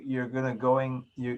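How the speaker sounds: ordinary talk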